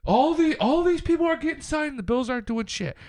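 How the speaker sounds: high, whiny fan rant; mocking whiny fans. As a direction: mimic a panicky fan complaining. Mocking fans' complaints on Twitter.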